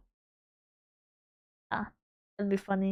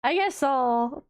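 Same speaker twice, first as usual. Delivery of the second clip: ordinary talk, nothing marked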